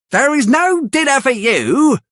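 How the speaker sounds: high, cold voice of a mean woman; high-pitched mean stepmother type turning a hungry boy away; Missus Sowerberry, cold. Acted. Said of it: "says Missus Sowerberry".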